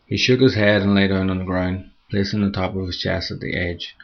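plain reading voice

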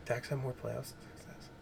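mocking mumble. Mocking friend's argument back.

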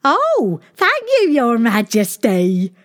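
high relieved sighing ladies; cartoon princess. A narrator's reading. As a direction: gush with relief. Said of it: "they sighed".

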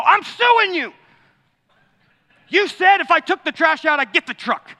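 high, loud indignant protest; hammed-up cry of betrayal at dad; mock outraged son. Son protesting loudly at his dad over the truck, hammed-up outrage.

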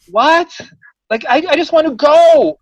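at the end of his rope; exasperated disbelief. Retold or imitated. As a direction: tenant fed up with the landlady's accusations. Exasperated reaction to landlady.

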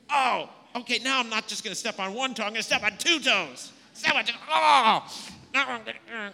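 over-the-top playful payback; mock escalating revenge. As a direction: ham up a kid escalating the fight. Escalating toe-stepping skit.

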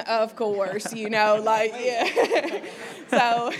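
loud laughing through the words; real laughter. Real, not acted. On stage, laughing at her old self, laughing loud.

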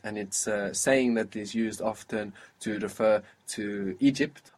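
ordinary delivery

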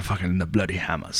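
hushed, gruff movie-star read of the band name; mock tough-guy voice. Imitating Liam Neeson saying the band name, a light mock growl. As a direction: lightly growl it like an action hero. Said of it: "I wanna hear Liam Neeson or fucking Tom Hardy say that".

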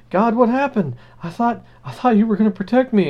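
high, hammed-up confusion; a hint of a baffled believer; mock bewildered man. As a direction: plead with God in heaven. Drowned man asks God.

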